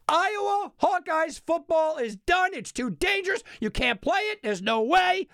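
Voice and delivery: loud, high mock outrage; mocking outrage at the cancellation; sarcastic. Real, not acted. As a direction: ham up the ridiculous ban. Mocking the ban, raised voice.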